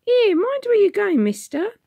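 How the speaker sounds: put-on very high, loud scolding girl; sharp rebuke. A narrator's reading. Young girl to Tom, sharp rebuke. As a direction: snap at the stranger. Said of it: "she rebuked him sharply".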